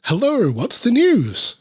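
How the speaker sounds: a hint of an eager little bunny asking for gossip; reader's light rabbit voice to a visitor; lively rabbit voice. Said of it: "not even trying to do the voice anymore".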